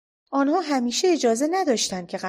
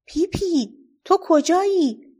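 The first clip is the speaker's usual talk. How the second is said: high, loud worried call to a friend out of sight; worried shout; 8 semitones higher, 5 dB louder, slower than usual. A narrator's reading. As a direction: call out anxiously, much higher and louder. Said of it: "تامی نگران شد و داد زد". (فریاد نگران و بلند)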